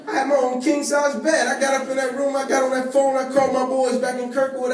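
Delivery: can't contain the thrill; excited. Real, not acted. Telling the audience about his first bed, excited.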